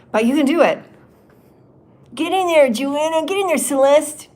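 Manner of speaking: high, loud rallying cheer; cheering encouragement. Retold or imitated. Spirits cheer her on, loud and high-pitched. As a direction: pump her up like a coach.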